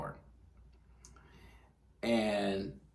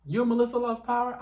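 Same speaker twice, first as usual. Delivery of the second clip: put-on much higher, astonished old-lady voice; grandma's question; 4 dB louder than usual. Grandma asking granddaughter about the power, high incredulous voice. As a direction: ask it like a disbelieving grandma. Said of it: "And she was like".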